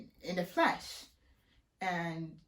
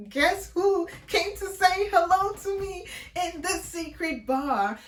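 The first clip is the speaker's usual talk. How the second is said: dramatic anguished build-up; 10 semitones higher, 6 dB louder, slower than usual. Real, not acted. Dramatic reveal with cry.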